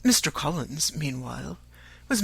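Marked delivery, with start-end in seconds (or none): none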